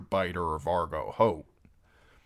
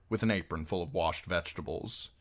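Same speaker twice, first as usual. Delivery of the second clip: ordinary delivery, nothing marked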